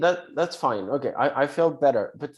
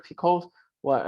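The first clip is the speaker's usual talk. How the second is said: ordinary delivery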